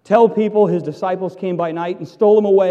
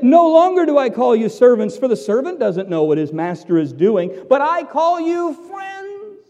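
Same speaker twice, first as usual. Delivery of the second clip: tender Jesus; 6 semitones higher, 5 dB louder than usual. Pretend. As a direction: say it as a loving promise. Quoting Jesus warmly.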